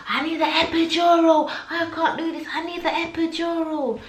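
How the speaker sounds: high, pleading cry for relief; desperate begging. Retold or imitated. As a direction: plead like you're at your limit. Begging for epidural retold.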